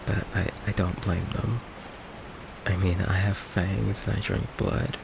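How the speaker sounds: soft, halting and resigned; quiet hurt. Acted. Vampire confessing that loved ones always leave, quiet hurt. Confesses everyone close runs away.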